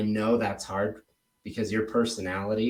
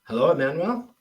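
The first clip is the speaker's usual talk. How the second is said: higher, questioning phone greeting; cold-calling agent; 5 semitones higher than usual. Pretend. Agent calls homeowner on expired listing, rising phone greeting. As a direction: check you have the right person, voice lifting. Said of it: "if I were to call you".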